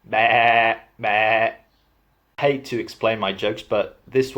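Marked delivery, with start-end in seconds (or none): 0.1-1.5 mock sheep bleating meh. Shown as a demonstration. Joking that the Welsh are sheep, a mock bleat. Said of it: "Welsh could be summed up as something like this".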